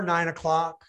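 ordinary talk, nothing marked

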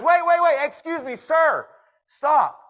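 high, loud shout after someone leaving; calling out. Acted. A local chasing the cowboy, shouting high and loud.

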